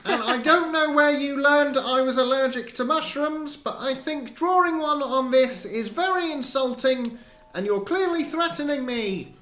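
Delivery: high, loud indignant crier; town crier voice. Acted.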